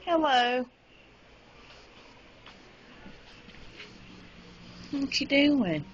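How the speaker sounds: high sing-song coo at the animals; high cooing pet voice; pet talk. Real, not acted. Coos at the pets.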